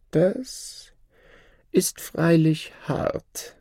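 high, slow, false pity; mock-sorry shadow. The Shadow faking sorrow for the scholar, hammed-up high voice. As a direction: pretend to regret it.

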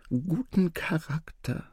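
high, soft, slow stage whisper; feed the word discreetly to someone; hushed, high-pitched prompting. A narrator's reading. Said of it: "flüsterte der Wesir".

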